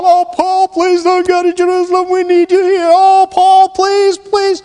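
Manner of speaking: high, wailing mock plea on one sustained pitch; mock begging. Christians in Caesarea plead with Paul not to go, hammed-up begging. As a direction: beg him in a hammed-up high whine. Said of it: "began to beg the Apostle Paul".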